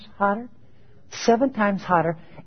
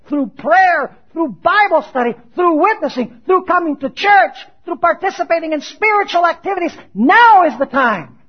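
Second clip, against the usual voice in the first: raised, driving list with a loud finish; forceful preaching; 8 semitones higher, 6 dB louder than usual. Acted. Preacher urging the congregation to develop faith now, forceful. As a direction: build urgency from the pulpit.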